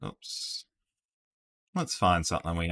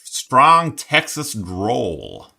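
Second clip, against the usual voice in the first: Texas drawl; 5 semitones higher, 8 dB louder, much slower than usual. Shown as a demonstration. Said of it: "didn't sound anything like a Texan".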